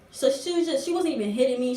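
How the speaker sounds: ordinary talk, nothing marked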